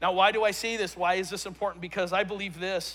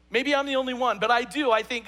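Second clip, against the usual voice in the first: ordinary talk, nothing marked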